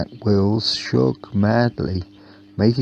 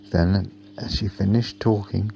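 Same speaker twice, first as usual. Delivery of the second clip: plain reading voice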